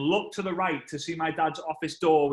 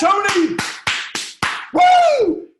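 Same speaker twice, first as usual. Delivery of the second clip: hype shout; 10 dB louder, much slower than usual. Real, not acted. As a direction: shout the name out high and loud. Crowd hype, calling out name.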